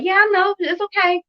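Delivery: lightly mock the other mom's easygoing yes; other mom on phone. Mother retelling the phone call, mimicking the other mom's breezy voice. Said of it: "and the mom's like".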